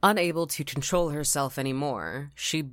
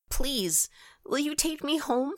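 put-on little girl voice; 13 semitones higher than usual. Little girl pleads with stranger.

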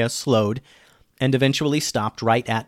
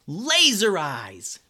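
high, long-drawn-out cheer; 7 semitones higher, much slower than usual. A narrator's reading. Two girls discovering their new power, cheering. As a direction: stretch it out in a high, slow cheer. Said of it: "they both cheered".

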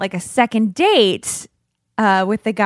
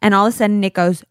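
ordinary talk

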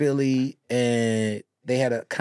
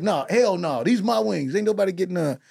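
quoting APR; 6 semitones higher than usual. Retold or imitated. APR quoted refusing.

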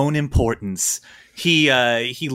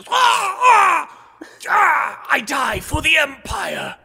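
high, hammed-up death cry; dying cry; 14 semitones higher, 3 dB louder, much slower than usual. Stabbed man crying out, melodramatic dying wail. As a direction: wail out heroic last words, slowing down. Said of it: "who cries out".

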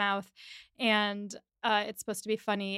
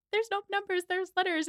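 high-pitched mock exasperation; pitched-up, insistent comeback; bickering retort; 10 semitones higher than usual. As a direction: imitate her arguing back over the map.

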